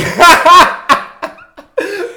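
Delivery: high burst of laughter; loud laughing. Real, not acted. Laughing at her reaction.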